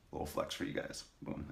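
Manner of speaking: whispered aside. Real, not acted. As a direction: whisper it while flexing. Flexing on camera.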